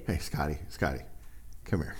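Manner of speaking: put-on a soft dad beckoning his boy; dad calling softly. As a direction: coax the kid over like a gentle dad. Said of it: "This is what my dad used to do with me".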